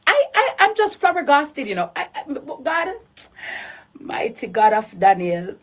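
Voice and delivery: disbelieving laughter; flabbergasted laugh. Real, not acted. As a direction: laugh at the absurdity.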